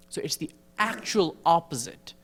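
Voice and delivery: ordinary talk, nothing marked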